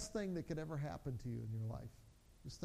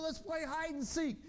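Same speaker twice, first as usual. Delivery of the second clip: lightly voiced excited child, higher pitch; eager kid, imitated; 8 semitones higher than usual. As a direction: pitch it up like a kid proposing a game. Kids begging to play.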